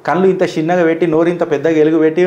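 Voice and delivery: ordinary delivery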